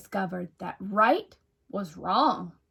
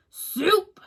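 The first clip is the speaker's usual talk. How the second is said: snarled; 10 semitones higher, 4 dB louder than usual. A narrator's reading. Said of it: "snarled the scruffy varmin".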